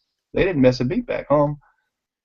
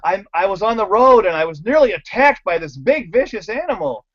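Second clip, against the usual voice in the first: flustered; 8 semitones higher than usual. Retold or imitated. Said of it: "he came back all flustered".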